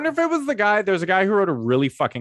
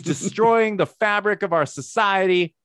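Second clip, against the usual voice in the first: higher, hammed-up pundit voice pitching hot takes; mock right-wing pundit; 6 semitones higher than usual. Mocking a right-wing guest who wants a podcast spot, hammed-up high pundit voice. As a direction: play a smug culture-war guest angling for a booking. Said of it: "I can shit talk liberals".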